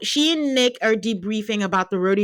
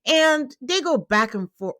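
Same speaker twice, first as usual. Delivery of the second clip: usual speaking voice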